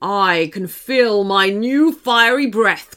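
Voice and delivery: big, forceful dragon threat; storybook monster, emphatic and ominous; menacing dragon. Acted. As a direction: promise fire with villainous relish. Dragon threatens fiery breath.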